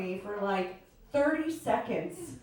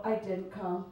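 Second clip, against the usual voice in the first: deadpan; 6 semitones lower, 6 dB quieter than usual. Retold or imitated. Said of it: "I just look at him and go".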